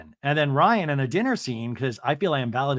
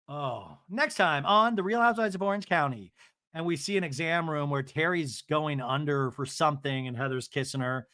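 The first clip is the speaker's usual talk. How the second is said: normal talking voice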